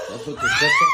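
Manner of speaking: shocked disbelief. Acted. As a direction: jump way up high in disbelief. تكرار مندهش لسبب البكاء. (صدمة وعدم تصديق بصوت عالي جدا)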